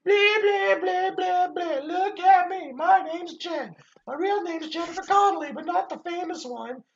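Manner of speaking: high-pitched mocking impression; squeaky silly voice, slow; impression of Jen. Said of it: "that's my you impression".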